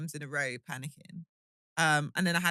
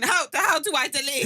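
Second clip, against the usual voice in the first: panicked; 9 semitones higher, 6 dB louder than usual. Retold or imitated. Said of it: "Ringing Stephanie saying".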